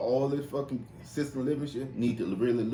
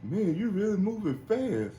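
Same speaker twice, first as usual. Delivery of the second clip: slow mock old man; 11 semitones higher than usual. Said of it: "He said".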